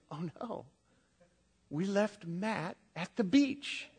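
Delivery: retold horror, drawn out slowly; mock dawning dread. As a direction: act out a parent's sinking realization. Retelling parents' dawning horror.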